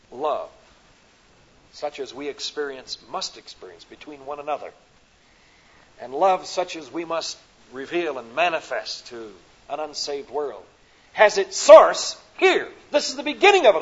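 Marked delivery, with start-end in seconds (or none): none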